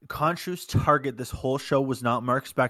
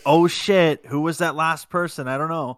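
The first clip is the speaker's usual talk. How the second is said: startled, louder and higher; shocked surprise; 5 semitones higher, 6 dB louder than usual. Retold or imitated. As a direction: blurt it out like you just saw a stranger appear. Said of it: "somebody else like".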